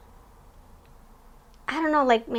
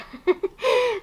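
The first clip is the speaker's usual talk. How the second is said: delighted laugh; 12 semitones higher, 4 dB louder than usual. Real, not acted. Laughing at her own Peter Pan call.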